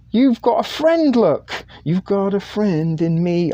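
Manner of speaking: high-pitched delighted exclamation; excited. Real, not acted. Telling Nancy she has a friend, high and excited. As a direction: burst out high with the good news. Bursts into a film song line.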